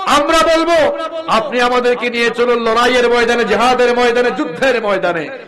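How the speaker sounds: loud, driving vow; rally the listeners behind you; rousing pledge. Acted. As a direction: companion loudly promising to follow into battle. Pledge continues.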